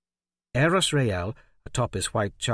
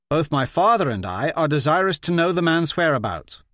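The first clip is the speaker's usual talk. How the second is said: raised, imperious prince voice; commanding prince; 6 semitones higher, 5 dB louder than usual. A narrator's reading. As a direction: question him with cold authority. Prince interrogates the Baron.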